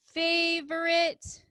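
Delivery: drawn-out favorite while writing; slowly spelled out. Shown as a demonstration. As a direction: stretch the word as you write. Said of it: "teacher sounds out while writing".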